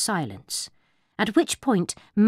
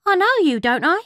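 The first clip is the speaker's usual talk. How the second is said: barmaid voice; 10 semitones higher, 7 dB louder, faster than usual. A narrator's reading.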